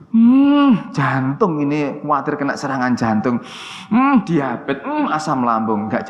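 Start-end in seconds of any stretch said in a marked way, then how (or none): 0.1-0.9 high, loud drawn-out "hmm"; mock pondering hum. Shown as a demonstration. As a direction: hum as if suddenly remembering the danger. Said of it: "penyakit teko".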